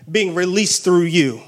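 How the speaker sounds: normal talking voice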